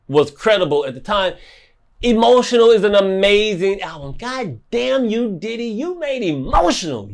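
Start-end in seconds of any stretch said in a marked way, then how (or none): 4.2-7.1 mock curse. Real, not acted. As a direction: drag it out in loving exasperation. Exasperated praise of the album.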